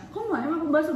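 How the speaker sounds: imitated snippy question. Girlfriend corrects how boyfriend asked about bakso, imitated impatient tone. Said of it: "nggak kayak gitu ngomongnya".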